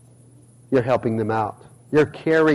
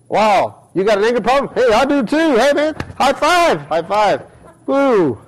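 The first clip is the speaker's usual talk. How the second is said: hammed-up high excited bro, slowing on high five; mock buddy voice; 7 semitones higher than usual. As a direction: play a goofy pal cheering for the anger. Preacher mocks fellow angry believer.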